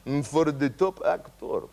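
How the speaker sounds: slow, grand awards-host voice; lightly hammed-up Russian presenter; mock slow announcer. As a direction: draw it out like a ceremony host. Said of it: "the guy says".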